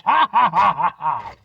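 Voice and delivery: a fake evil laugh that sinks down; mock gloating laugh. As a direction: cackle like a cartoon villain out to spite him. Imagined fan gloating at him.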